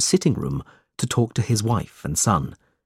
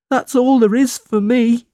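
crying out; 13 semitones higher, 5 dB louder than usual. A narrator's reading. Smike pouring out his misery to Nicholas, high tearful wail. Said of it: "cried Smike".

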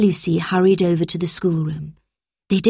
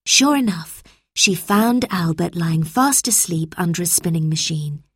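plain reading voice

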